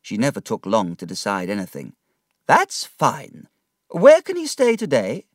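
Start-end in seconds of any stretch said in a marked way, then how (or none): 2.5-5.3 put-on higher, decided old aunt voice; brisk aunt. Aunt deciding David's schooling, brisk. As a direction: set out the plan firmly.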